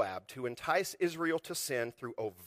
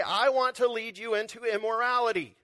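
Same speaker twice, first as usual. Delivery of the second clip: mock declaration; 7 semitones higher, 5 dB louder than usual. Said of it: "walk in and say".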